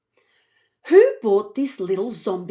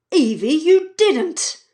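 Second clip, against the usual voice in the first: slow, louder reproach; disbelieving dad; 6 semitones higher, 5 dB louder, slower than usual. Acted. Dad to Evie, slowly reproachful. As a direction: scold in disbelief. Dad realising she went out.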